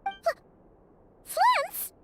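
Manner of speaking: a startled, breaking question; shocked stammer. Acted. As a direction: trip over the name in shock. Shocked at suspect's name.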